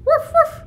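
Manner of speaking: high little woofs; very high-pitched pretend barking; dog bark imitation. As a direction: yip like a small dog.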